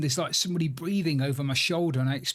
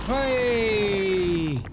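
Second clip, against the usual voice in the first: shout; 6 semitones higher, 6 dB louder than usual. Real, not acted. Calling out at the dog.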